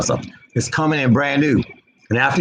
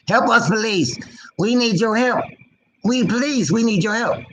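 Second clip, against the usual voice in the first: high-pitched mock cry for help; put-on pleading voice; mock plea; 7 semitones higher than usual.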